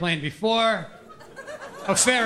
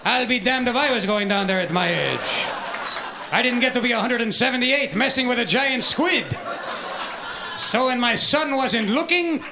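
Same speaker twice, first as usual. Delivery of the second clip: Jacques Cousteau. Acted. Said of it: "let us join Jacques Cousteau".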